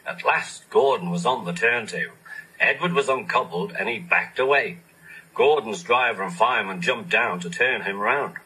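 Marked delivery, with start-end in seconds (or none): none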